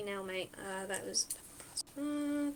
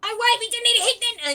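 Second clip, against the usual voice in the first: much higher, louder, fast panicked goblin cry; panicked enemy; 11 semitones higher, 11 dB louder, faster than usual. Acted. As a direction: blurt it out in a frantic squeal. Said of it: "and you hear".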